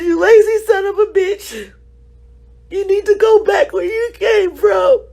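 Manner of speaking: tearful mock yell of the man's insult; loud, tearful imitation of a stranger's insult; retold angry shout, crying. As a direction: shout the insult through tears, voice breaking. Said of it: "He says".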